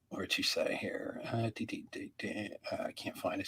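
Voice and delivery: under-breath whisper while searching; hushed searching mumble. Real, not acted. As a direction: murmur to yourself as you scroll. Muttering while searching chat.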